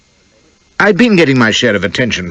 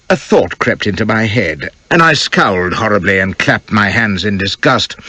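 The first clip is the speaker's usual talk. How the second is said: usual speaking voice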